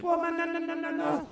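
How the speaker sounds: squeaky high monkey chatter. Retold or imitated. Monkey reacting to the buck.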